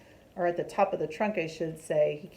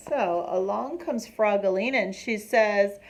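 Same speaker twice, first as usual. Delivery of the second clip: ordinary delivery, nothing marked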